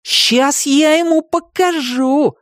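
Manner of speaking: put-on high, slow boy's threat; boyish threat. A narrator's reading. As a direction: puff up like a brave boy. Tim threatens to fight.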